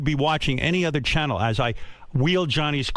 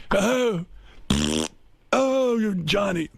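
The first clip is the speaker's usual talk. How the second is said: Joan Rivers mock; 7 semitones higher, much slower than usual. Joan Rivers voiced in pitch.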